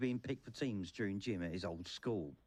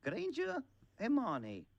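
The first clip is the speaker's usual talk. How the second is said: high-pitched calling out of a name; surname pitched high, first name dropping; roll call; 13 semitones higher, 3 dB louder, slower than usual. A narrator's reading. Teacher calling the roll of names.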